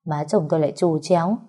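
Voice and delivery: ordinary delivery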